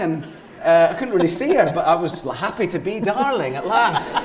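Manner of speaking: ordinary talk, nothing marked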